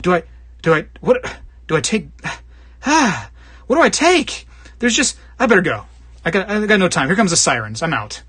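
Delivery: a hint of a flustered thief, pitch jumping up; lightly voiced thief panicking before the sirens; panicked burglar. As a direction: play a rattled burglar grabbing loot, then bolting. Thief in hoarder's house.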